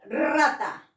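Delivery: rata with a rolled r at the start, slow; strong initial r. Shown as a demonstration. Teacher models the trilled r of rata. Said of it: "Suena fuerte".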